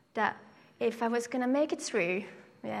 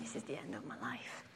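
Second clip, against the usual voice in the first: hushed dread; 11 semitones lower, 13 dB quieter than usual. Retold or imitated. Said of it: "and I'm thinking".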